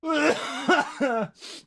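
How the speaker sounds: high burst of laughter; real laughing fit. Real, not acted. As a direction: crack up all over. Cracks up at the mishap.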